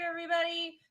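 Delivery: ordinary talk, nothing marked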